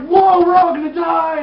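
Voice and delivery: high, loud, drawn-out mock panic; freaking out. Man sure all will die, mock freak-out. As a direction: wail it in hammed-up terror. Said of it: "he's freaking out".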